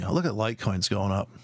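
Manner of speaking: ordinary talk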